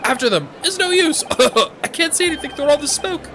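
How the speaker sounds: high, loud cries of alarm; acted panic. Crowd as smoke fills courtroom, panicked. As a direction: shout in panic, coughing between cries.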